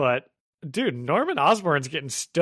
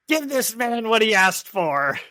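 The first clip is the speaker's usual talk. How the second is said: high, slow menacing Goblin line; Willem Dafoe impression; 8 semitones higher than usual. Host doing Goblin ordering the Tinkerer, in Dafoe voice. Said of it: "I'll try to do a William Dafoe voice".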